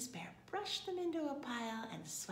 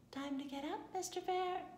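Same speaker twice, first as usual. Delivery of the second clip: high, lilting call to a sleeper; higher-pitched wife waking her husband; coaxing wake-up call; 10 semitones higher than usual. A narrator's reading. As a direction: sing it up gently to rouse him. Missus Bear trying to wake husband.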